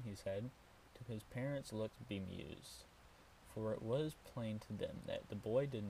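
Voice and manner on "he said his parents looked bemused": ordinary delivery, nothing marked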